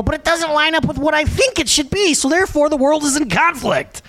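put-on high, whining child's complaint; whiny kid. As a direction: sulk like a toddler who lost. Kid complaining world wrong.

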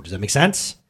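ordinary talk

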